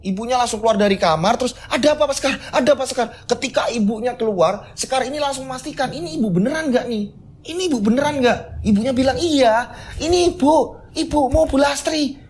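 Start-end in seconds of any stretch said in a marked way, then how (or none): 1.7-3.1 high, alarmed mother's question; ibu panik. Acted. Ibu bergegas keluar, panik. 5.9-8.6 high-pitched frightened questioning; panik memastikan. Acted. Sekar panik memastikan ibunya. 9.2-12.2 high, insistent mother voice; ibu meyakinkan. Acted. Ibu meyakinkan anak yang panik.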